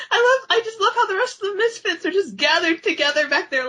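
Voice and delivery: high, laughing delivery. Real, not acted. Laughing while describing the Misfits to cohosts. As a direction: talk through the giggles.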